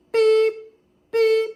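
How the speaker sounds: loud high beep, beep like a reversing truck; imitated backup beeps. Mimicking the tractor's beeping as it backs up, loud and high. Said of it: "So I'm hearing beep".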